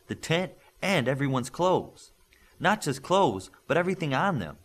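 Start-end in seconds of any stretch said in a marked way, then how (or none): none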